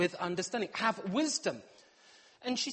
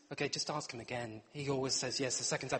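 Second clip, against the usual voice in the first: plotting aside; 7 semitones lower, 6 dB quieter than usual. Retold or imitated. Children whisper plotting.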